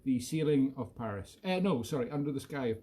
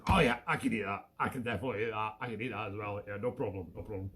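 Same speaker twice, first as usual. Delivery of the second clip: Toki's imagined voice; 5 semitones lower, faster than usual. Pretend. Owner voicing her dog, low and greedy, fading away. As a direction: mumble it greedily like a hungry dog, trailing off. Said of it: "this is what I imagine Toki says".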